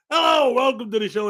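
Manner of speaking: high, bright hello to the crowd; showy host greeting. Acted. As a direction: greet them like a show host. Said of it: "like we always do".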